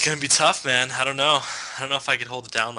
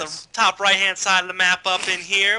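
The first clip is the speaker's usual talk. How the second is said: higher, louder hillbilly opening; 4 semitones higher, 3 dB louder than usual. Pretend. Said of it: "like, a redneck hillbilly impression?".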